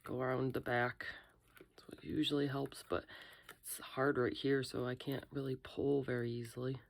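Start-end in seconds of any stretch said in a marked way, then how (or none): 0.0-2.8 whispering. Real, not acted. Careful demolding, whispers.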